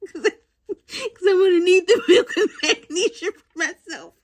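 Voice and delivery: high, laughing through the words. Real, not acted. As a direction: laugh while confessing the joke. Laughing at own joke.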